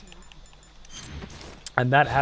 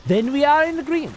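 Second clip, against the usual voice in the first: mock pompous; 12 semitones higher, 5 dB louder than usual. Mock formal debate with viewer.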